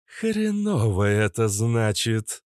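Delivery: slow, growled creature voice; drawn-out snarling verdict; growling cobra. Acted. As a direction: growl it out slowly. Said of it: "прорычала кобра".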